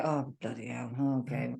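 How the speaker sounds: low, soft, deflated "oh bloody hell"; resigned sigh. Retold or imitated. To herself about the diet, low and soft. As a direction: mutter it low like giving up with a shrug. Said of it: "If I just sit there and just go".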